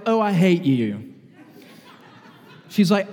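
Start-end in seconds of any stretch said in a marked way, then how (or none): 0.1-1.1 mild mock hatred; a boss's mood flip, half-laughed; imitating bipolar boss. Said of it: "she really did say it like this".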